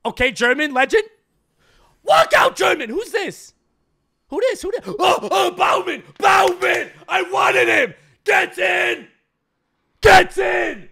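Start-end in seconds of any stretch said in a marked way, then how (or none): 2.0-10.9 high, loud, thrilled yelling at the reveal; excited shouting. Real, not acted. Gamer shouting as the legend he wanted walks out. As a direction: shout out the player you wanted.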